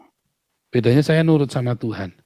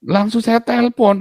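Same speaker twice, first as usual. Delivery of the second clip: normal talking voice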